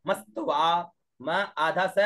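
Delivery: usual speaking voice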